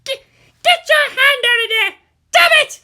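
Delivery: put-on high, loud girl yelling; shouting. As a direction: yell in outrage at a groping hand. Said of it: "she shouted".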